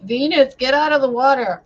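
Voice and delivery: loud, high call to Venus. Acted. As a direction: call her out of the water. Mermaid Venus called out of water.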